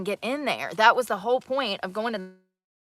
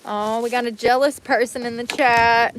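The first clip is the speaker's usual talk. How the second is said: loud teasing; 3 semitones higher, 7 dB louder than usual. Real, not acted. Teasing a jealous chatter.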